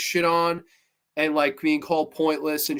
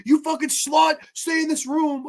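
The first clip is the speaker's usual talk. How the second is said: high-pitched imitation yell; hammed-up movie yelling, not full volume; mock yell; 8 semitones higher than usual. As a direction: act out an over-the-top order. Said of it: "Bert yelling".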